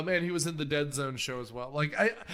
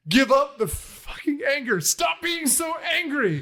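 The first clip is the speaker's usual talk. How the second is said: loud, high mock scolding; exasperated scolding; 8 semitones higher, 4 dB louder than usual. Podcaster imitating an actress telling the Beast off, loud and exasperated. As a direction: scold at the top of your voice, dragging the last words. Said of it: "it's just Angela Lansbury going".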